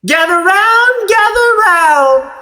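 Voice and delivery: high, bright call to the crowd; showwoman calling out. A narrator's reading. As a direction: draw the crowd in like a carnival barker. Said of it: "a woman speaks".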